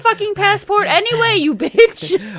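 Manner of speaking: teasing through laughter; laughing. Real, not acted. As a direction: laugh while insulting a friend.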